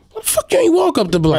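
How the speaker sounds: high, disbelieving outburst; incredulous. Real, not acted. Mocking the lazy kids, high incredulous retort. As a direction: pitch jumps up in disbelief.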